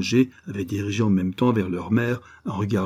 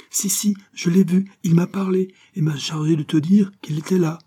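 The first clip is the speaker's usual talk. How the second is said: put-on high, louder, excited little boy; insistent child; 10 semitones higher, 5 dB louder than usual. A narrator's reading. Boy contradicts his disbelieving mother, excitedly insistent. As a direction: protest eagerly that it is true.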